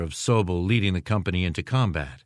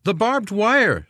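much higher, drawn-out shouted reply; calling back; 12 semitones higher, 4 dB louder, slower than usual. A narrator's reading. Captain answers supposed major on night march, calling back. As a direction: call the answer back, stretching it.

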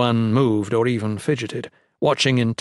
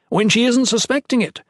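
put-on high, loud, insistent young padre; arguing; 13 semitones higher, 6 dB louder than usual. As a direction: push back hard, voice raised high. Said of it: "Ethan argued".

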